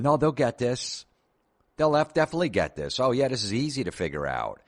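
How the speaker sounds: high, excited family voices; confident customers. Retold or imitated.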